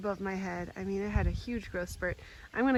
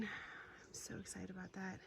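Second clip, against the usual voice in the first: whisper; 16 dB quieter than usual. Real, not acted. Excited about her lilies.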